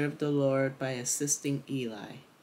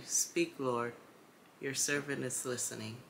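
quiet instruction; 8 dB quieter than usual. A narrator's reading. Eli tells Samuel what to say, quiet instruction. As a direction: softly give the words to repeat.

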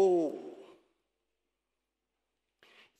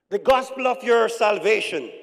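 loud, measured scripture reading; slow reading; 4 semitones higher, 11 dB louder than usual. Real, not acted. Preacher reading Paul's verse aloud to church, slow reading. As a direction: proclaim the verse deliberately. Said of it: "I'm gonna read it slowly".

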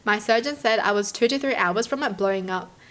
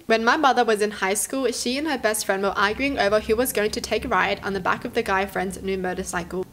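usual speaking voice

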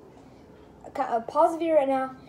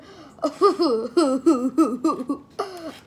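real laugh, loud and long; helpless laughter; 3 dB louder than usual. Real, not acted. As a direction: burst out laughing. Laughing after pie in face.

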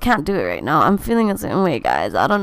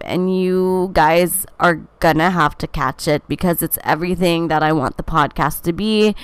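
usual speaking voice

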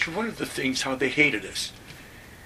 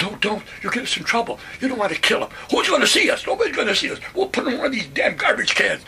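much higher, louder urgent back-and-forth; urgent pleading; 11 semitones higher, 5 dB louder, faster than usual. Retold or imitated. Narrator holding his buddy back from killing the sergeant, urgent and loud. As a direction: plead hard to stop him. Speaker pleading and arguing with guy.

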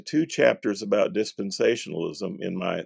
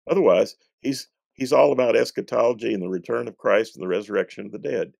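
normal talking voice

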